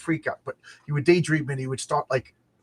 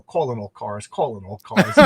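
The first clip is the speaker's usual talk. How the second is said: lightly imitated low radio dispatcher; a kid playing dispatcher on the mike, kept low; radio dispatcher call; 5 semitones lower than usual. Kid grabs the priest's microphone.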